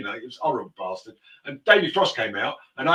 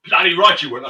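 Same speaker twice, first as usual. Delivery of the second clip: loud indignant retort; snapping back; 3 semitones higher, 10 dB louder than usual. Retold or imitated. Maxwell loudly snapping at his driver. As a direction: snap it back, offended.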